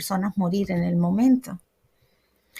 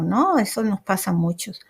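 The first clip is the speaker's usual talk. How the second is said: ordinary talk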